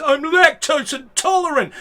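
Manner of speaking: high, loud pained squeal; a hint of a choking toff; strangled whine. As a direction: gasp it out in distress. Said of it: "spits it all back out again and says".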